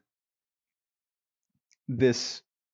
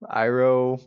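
ordinary delivery

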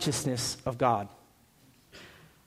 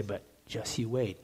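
much softer, slow, lowered just you wait; ominous Arizona warning; 6 semitones lower, 10 dB quieter than usual. Retold or imitated. Pastor quoting Arizonans on the heat, a soft, slow ominous warning. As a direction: hush it down like a quiet threat. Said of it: "people say here, too".

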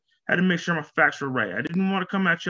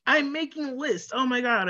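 excited; 8 semitones higher than usual. Real, not acted. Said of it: "I'm excited".

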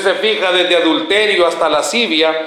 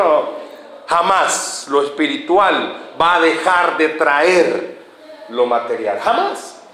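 slow emphatic; 3 semitones lower, slower than usual. Acted. Preacher slows for emphasis.

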